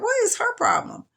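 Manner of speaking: high, baffled question. Retold or imitated. Confused patient wondering about the nurse's look, high and baffled. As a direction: ask it way up, puzzled.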